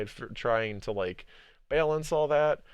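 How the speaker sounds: ordinary talk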